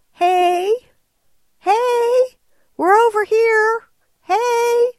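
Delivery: high, loud bleating yell of hungry sheep. Retold or imitated. Sheep yelling for dinner at the driver, high and loud. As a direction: shout it up high like a sheep begging for food. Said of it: "continued to yell at me".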